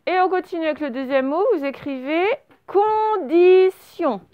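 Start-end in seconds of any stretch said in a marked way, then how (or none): 2.7-4.2 very slow, loud, raised dictation; syllable by syllable. Shown as a demonstration. Teacher dictates second word to pupils, syllable by syllable. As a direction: stretch each syllable high and strong. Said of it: "fonctionnez bien syllabe par syllabe".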